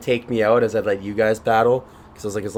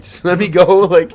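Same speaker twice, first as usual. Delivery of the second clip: laughing plea; 8 semitones higher, 12 dB louder, slower than usual. Retold or imitated. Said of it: "I'm just like".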